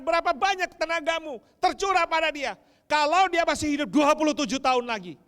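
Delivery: God challenging the parents, loud; preaching. Acted. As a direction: press each question home. God's voice, preaching.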